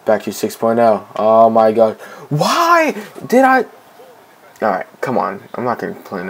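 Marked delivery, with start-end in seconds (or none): 2.3-3.6 high, drawn-out cry of frustration; exasperated wail. Real, not acted. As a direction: wail it slowly, pitched way up in exasperation. Frustrated at own mistake.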